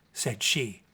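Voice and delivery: plain reading voice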